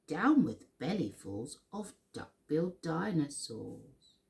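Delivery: plain reading voice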